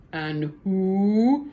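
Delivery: huge booming giant voice; thundering giant. A narrator's reading. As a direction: boom it out like a giant. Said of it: "thundered the giant".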